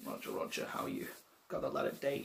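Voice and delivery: ordinary talk, nothing marked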